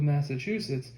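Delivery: ordinary talk, nothing marked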